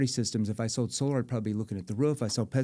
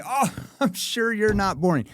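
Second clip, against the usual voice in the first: laughing high reply; laughing playful homeowner; 10 semitones higher, 4 dB louder than usual. Shown as a demonstration. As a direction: laugh it off warmly. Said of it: "playful tone".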